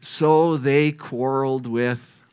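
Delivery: ordinary talk, nothing marked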